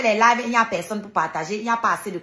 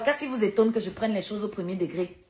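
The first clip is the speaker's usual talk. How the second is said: usual speaking voice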